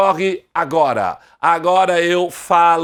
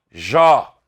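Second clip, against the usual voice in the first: Jó said alone, louder; stressed syllable; 3 semitones lower, 4 dB louder than usual. Shown as a demonstration. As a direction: hit the syllable Jó. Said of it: "Qual é a sílaba tônica".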